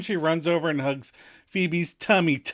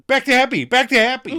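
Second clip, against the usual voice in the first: high, louder hurried insistence; backpedaling; 11 semitones higher, 9 dB louder than usual. Retold or imitated. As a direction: steer them quickly back to joy. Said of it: "Phoebe is now regretting".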